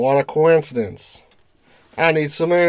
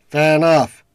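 normal talking voice

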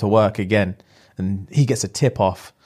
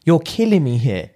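raised-pitch acted anguish; pained plea. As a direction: plead as a wounded lover, voice lifted. Acts out hurt man pleading with her.

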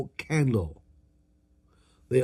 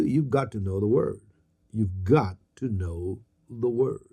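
low intense; 7 semitones lower, 6 dB quieter than usual. Acted. Preacher drives home a point.

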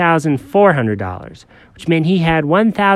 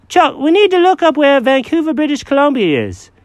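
high, fussy cat voice; Jenkins the cat; 4 dB louder than usual. Acted.

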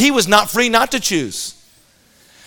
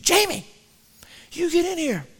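a much higher, sharper mom's call; stern mother calling; 9 semitones higher, 9 dB quieter than usual. Retold or imitated. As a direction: mom loses patience and calls her son in.

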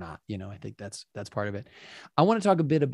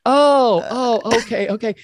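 taken-aback sister; 11 semitones higher, 10 dB louder, slower than usual. Retold or imitated. Sister taken aback in retold quarrel.

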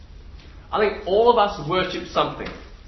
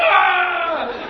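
crowd scream; 9 semitones higher than usual. Retold or imitated. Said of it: "And everyone goes".

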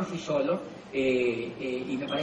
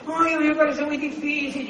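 much higher complaining voice; whiny doubter; 10 semitones higher than usual. Retold or imitated. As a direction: whine that it is too hard. Voicing doubtful people. (queja aguda y quejumbrosa)

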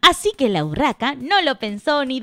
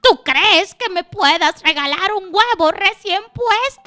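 exhausted magpie; 9 semitones higher, 5 dB louder than usual. Acted. Weary magpie begging the hen for an egg, high pleading. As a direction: beg in a high bird voice, rattling through the list. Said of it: "agotada, cansada, vio a la gallina negra y le dijo".

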